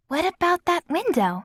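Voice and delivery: put-on high bright girl voice; girl's voice. A narrator's reading. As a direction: pitch up for the girl.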